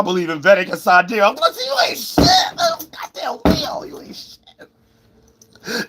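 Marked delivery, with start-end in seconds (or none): 1.3-5.9 very high shriek fading to a breathy cry; mock wail. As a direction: shriek then trail off crying. Mocking wail at Leo.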